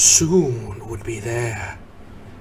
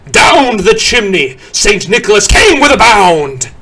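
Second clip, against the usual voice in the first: louder and higher; excited burst; 8 semitones higher, 9 dB louder than usual. A narrator's reading. Narrator announcing Saint Nick down the chimney, excited. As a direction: let him bound in.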